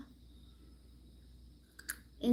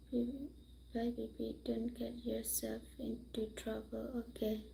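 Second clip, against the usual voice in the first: softer whispered warning; 11 dB quieter than usual. Real, not acted. Saying bye to baby, whispered. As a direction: whisper it gently.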